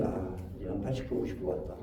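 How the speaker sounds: whispered thinking to yourself; inner thought. Shown as a demonstration. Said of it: "이렇게 생각하면 돼요". (속으로 혼잣말하듯 속삭이며)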